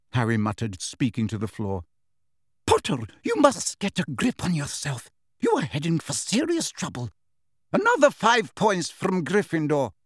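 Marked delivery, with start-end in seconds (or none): 2.7-9.9 high, clipped Scottish teacher's reprimand; a strict professor scolding a noisy pupil; stern Professor McGonagall. A narrator's reading. Professor rebukes Angelina in the Great Hall, stern.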